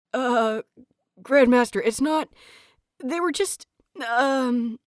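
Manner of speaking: high, halting and flustered; a caught student scrambling for words; panicked stammer. A narrator's reading. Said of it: "and finally panic".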